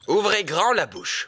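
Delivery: ordinary talk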